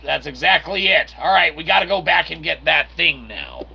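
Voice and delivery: usual speaking voice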